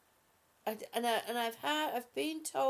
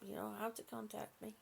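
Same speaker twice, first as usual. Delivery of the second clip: soft aside; 3 semitones lower, 10 dB quieter, faster than usual. Real, not acted. Repeat said softly.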